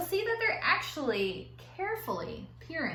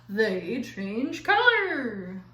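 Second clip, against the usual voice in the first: dramatic reveal; 5 dB louder, much slower than usual. Acted. Dramatic reveal, slow and loud.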